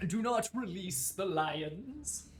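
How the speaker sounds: slow, grand imperial command; Roman emperor decree. Pretend. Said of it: "am I being judged in the Colosseum?".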